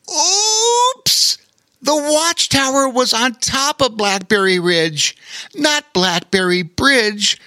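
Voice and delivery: high, sheepish otter voice; embarrassed otter. A narrator's reading. Otter realizes he misread the note, high and sheepish. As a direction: squeak out the mistake sheepishly. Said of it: "said the embarrassed otter".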